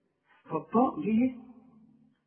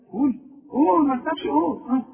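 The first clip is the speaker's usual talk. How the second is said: high, loud coaxing; urging; 9 semitones higher, 7 dB louder than usual. Real, not acted. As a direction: push the student to just say it. Urging student to repeat.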